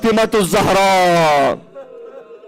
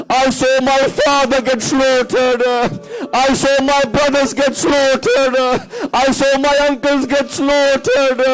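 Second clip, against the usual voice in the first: higher, mounting mournful cry, each line a step up; grieving lament; 6 semitones higher than usual. Acted. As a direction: build the grief line by line. Imam's words in rising lament.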